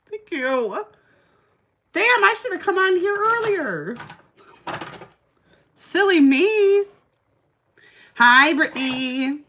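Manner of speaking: high, giddy, drawn-out self-teasing; giggly and coy after a compliment, slowly; playful flustered squeal. Real, not acted. Flustered by a viewer's compliment about her eyes, high and giddy.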